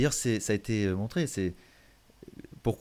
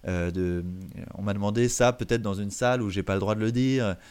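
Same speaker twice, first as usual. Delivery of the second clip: ordinary talk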